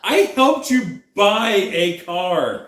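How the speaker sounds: high, drawn-out exasperated protest; mock-indignant rant. Real, not acted. Reminding partner of the car-buying favour, mock-indignant.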